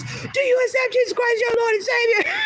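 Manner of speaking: high-pitched imitation of a man screaming; mock scream. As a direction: scream it like a zealot, hammed-up. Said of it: "scream in my face".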